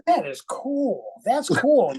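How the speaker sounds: high, impressed mock delight; admiring quote. Musician retelling his reaction on stage.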